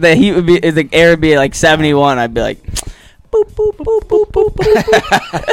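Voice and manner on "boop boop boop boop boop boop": high, slow beeping noises; thermostat beeps. Retold or imitated. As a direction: imitate button beeps. Imitating thermostat button beeps.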